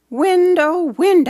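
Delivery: high, loud call up to the treetop. Acted. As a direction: call the wind to come back. Child calling to wind.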